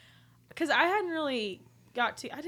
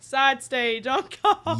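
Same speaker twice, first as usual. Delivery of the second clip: laughing; 3 semitones higher, 9 dB louder, much slower than usual. Real, not acted. Laughing at old rockers' medics.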